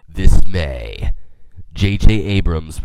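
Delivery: low, weighty trailer announcer; movie trailer voice. Shown as a demonstration. Podcaster trying out a new movie's trailer, low announcer voice. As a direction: attempt a deep film-trailer voiceover. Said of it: "do my movie trailer voice".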